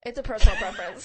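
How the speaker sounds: blurted out through a laugh. Real, not acted. Emphatic laughing claim.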